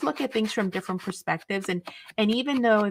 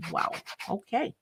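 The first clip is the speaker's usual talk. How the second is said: mock aside; 3 semitones higher, 6 dB quieter than usual. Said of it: "and I'm like".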